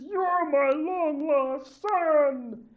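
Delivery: high, loud, very slow tearful cry; joyful revelation. Acted. Weeping chief embracing his lost son, high slow joyful cry. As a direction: draw out the joyful discovery. Chief reveals to boy, crying.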